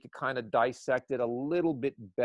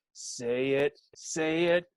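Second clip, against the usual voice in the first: urging like a Wheel of Fortune contestant; eager urging; 5 semitones higher than usual. Retold or imitated. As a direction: beg them to say it, game-show style. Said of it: "I'm like, in my head".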